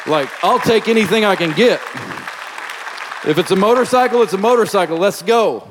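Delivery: emphatic, exultant declaration; fired-up preaching. Real, not acted. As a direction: punch it out like a jubilant preacher. Preacher exulting over answered prayer.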